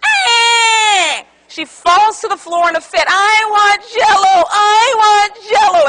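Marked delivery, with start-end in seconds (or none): 0.0-1.2 put-on loud crying shout like a four-year-old; child's shouted wail; toddler wail. Child throwing a fit. 3.1-5.9 put-on loud, slow, whining child's shout; daughter's tantrum, shouting and crying for Jell-O; whiny toddler tantrum. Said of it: "in this annoying, shrill, nails on the chalkboard kind of voice".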